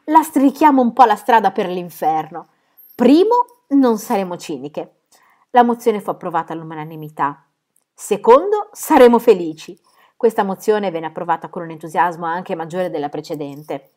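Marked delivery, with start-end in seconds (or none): 0.0-5.0 loud, high rallying call; rally the sisters cheerfully; shouted proposal. Acted. Phyllis rallies her sisters with resolutions, loud and high. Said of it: "gridò Phyllis". 7.9-9.8 loud, high rallying call; rally the sisters cheerfully; shouted proposal. Acted. Phyllis rallies her sisters with resolutions, loud and high.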